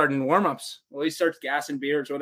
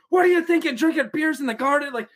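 much higher, outraged yelling; pissed-off coach; 11 semitones higher than usual. Retold or imitated. Coach furious at the drunk bus driver, mock yell. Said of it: "a coach was so pissed at the bus".

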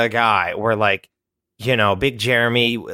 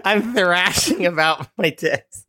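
higher, drawn out through laughter; laughing; 5 semitones higher than usual. Real, not acted. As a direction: talk while cracking up. Cracking up at the pitch.

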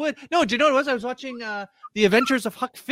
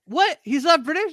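retold shock; 7 semitones higher than usual. Messaging her Lord of the Rings friend, retelling her stunned disbelief. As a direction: gasp it like the news floors you. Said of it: "I was like".

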